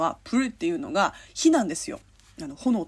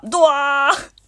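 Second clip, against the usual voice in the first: loud, higher mock wail; dramatic cry; 6 semitones higher, 8 dB louder, much slower than usual. Reading a listener's letter about missing the restaurant, dramatic cry. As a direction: belt out a comic cry of dismay.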